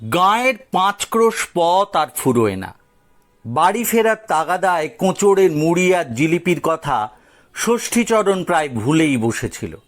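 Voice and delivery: plain reading voice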